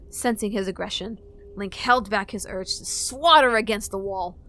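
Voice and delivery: ordinary delivery, nothing marked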